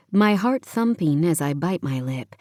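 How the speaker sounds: ordinary delivery, nothing marked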